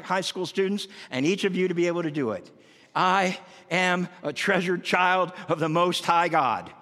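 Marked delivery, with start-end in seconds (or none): 3.0-6.7 slow declaration. Shown as a demonstration. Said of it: "That's my okayness statement".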